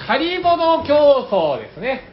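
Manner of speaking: 仮ボド競争 called out high and slow; announcing. Acted. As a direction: present 仮ボド競争 like a title card. Announcing the game title.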